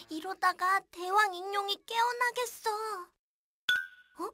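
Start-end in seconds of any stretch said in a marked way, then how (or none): none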